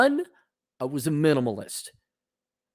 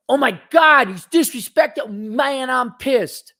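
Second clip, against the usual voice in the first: high, loud mocking rant; mock outrage; 8 semitones higher, 6 dB louder, slower than usual. Mocking the pissed-off worker, high and loud. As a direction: ham up the angry employee.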